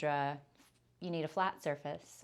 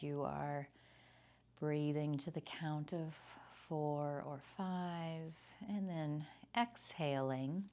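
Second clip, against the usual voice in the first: guided meditation. Acted. Leads a yoga nidra body scan.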